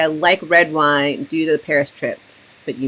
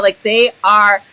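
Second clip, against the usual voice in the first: normal talking voice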